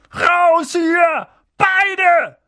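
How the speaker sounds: high, loud, strained croak; croaked shout. A narrator's reading. Uncle throws both boys out, croaking loudly. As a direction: force out a strangled order. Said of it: "krächzte Onkel Vernon".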